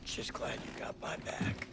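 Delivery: soft, slow whisper; whispered thanks. Acted. Soldier whispering thanks to his buddy. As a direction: murmur it close.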